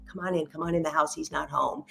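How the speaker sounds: low, hushed invitation, keeping it secret; a quiet, conspiratorial come-on-in; hushed coaxing. Retold or imitated. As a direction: coax her in under your breath. Sneaking friend in, husband away.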